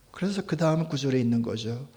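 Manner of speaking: ordinary delivery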